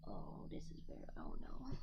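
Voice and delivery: ordinary talk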